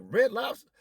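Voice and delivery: high incredulous echo; mock surprised Karen. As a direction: echo it back, baffled. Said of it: "She was like".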